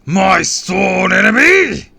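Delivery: long, high furious roar. A narrator's reading. Villain hearing his enemy's name, roaring. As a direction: roar it with drawn-out fury. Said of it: "he roared".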